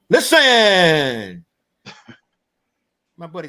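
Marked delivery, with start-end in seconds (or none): 0.1-1.4 loud, high, long-drawn falling holler; grandfather's holler. Retold or imitated. Grandfather calling to boys at play outside, drawn-out holler. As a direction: holler it out, stretching the last syllable. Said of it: "came up outside and said".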